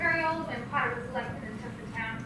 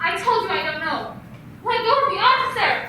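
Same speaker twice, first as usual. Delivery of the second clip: loud and higher, fighting him off; panicked woman shouting at a stranger to let go; frightened shout; 3 semitones higher, 8 dB louder than usual. Acted. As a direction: yell to get free. Struggling with stranger, calls police.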